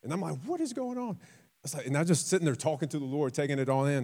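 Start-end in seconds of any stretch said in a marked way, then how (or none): 0.4-1.2 amazed. Retold or imitated. Preacher amazed at the change.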